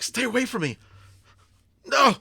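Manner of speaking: high, very loud cries of alarm; panicked shouting. Acted. Scared man to the person he grabbed, panicked shout. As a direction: yell to drive them off, voice leaping up. Character panics, shoving friend away.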